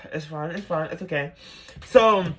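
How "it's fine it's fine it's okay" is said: soft self-soothing after a sigh; steady yourself quietly, on the breath; trying to breathe. Retold or imitated. Calming herself after being dumped, quiet and breath-led. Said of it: "I'm trying to breathe".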